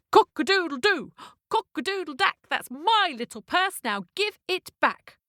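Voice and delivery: high rhythmic crow, slowing on the demand; crowing cockerel demand. Acted. Cockerel chasing the carriage for his purse, crowed demand. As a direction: chant it like an indignant cockerel, drawing out the ending.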